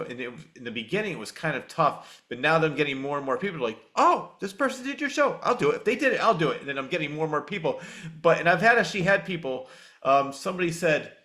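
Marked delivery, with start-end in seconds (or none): 4.0-6.6 high-pitched mock eager agreement; imitated eagerness. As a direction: voice an easily persuaded guest, pitched up. Imitating people now agreeing to appear.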